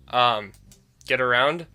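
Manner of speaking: ordinary talk, nothing marked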